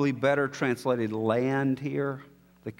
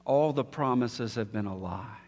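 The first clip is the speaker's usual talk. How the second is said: softer, lower, hushed reflection; quiet and sombre; 5 semitones lower, 7 dB quieter than usual. Acted. Preacher softly telling listeners history would be meaningless, hushed. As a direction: let it sink in quietly, almost to yourself.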